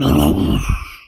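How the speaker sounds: sudden loud yelp; loud low creature yelp; startled dog yelp. A narrator's reading. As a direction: let out a quick startled cry. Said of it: "the big dog went".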